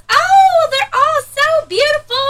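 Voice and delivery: very high, loud gushing stranger; mock gushing lady. Daughter mimicking the lady gushing over her children, high and loud. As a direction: swoon over the kids.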